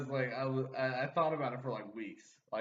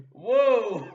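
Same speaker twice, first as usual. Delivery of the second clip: loud, high startled whoa; jolted by mom's question; shocked whoa; 9 semitones higher, 14 dB louder than usual. Retold or imitated. Son recalls his shock at mom's question, a loud high whoa.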